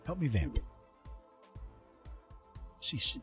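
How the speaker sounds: ordinary talk, nothing marked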